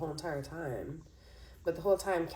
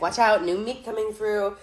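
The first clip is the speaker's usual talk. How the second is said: louder hallway call; mock announcement; 3 semitones higher, 7 dB louder than usual. Reader acting the movie boy's hallway call, louder. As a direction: call it out like clearing a path. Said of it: "And then he's like".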